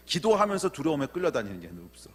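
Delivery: usual speaking voice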